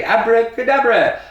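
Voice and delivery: big magician's abracadabra; loud magic word. Shown as a demonstration. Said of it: "One, two, three".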